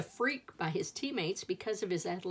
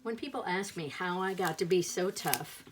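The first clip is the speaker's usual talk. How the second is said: ordinary delivery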